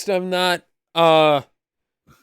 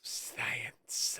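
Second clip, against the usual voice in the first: whispered hissing snake imitation; 13 dB quieter than usual. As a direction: hiss science back like a snake. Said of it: "I had to do the snake voice".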